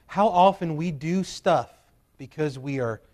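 ordinary delivery

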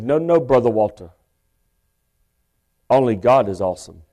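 ordinary talk

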